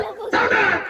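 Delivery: high call to attention; high-pitched parade-ground command; drill command. Pretend. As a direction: snap players to attention. Host calls drill command to players.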